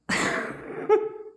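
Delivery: a squeaky, breathy mocking laugh; high mocking giggle. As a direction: giggle high like a smitten fool. Mocking the delusional listener.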